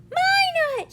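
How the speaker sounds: very high, loud crow; triumphant shout. Acted. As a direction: claim the prize in triumph. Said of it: "shouted Pecan triumphantly".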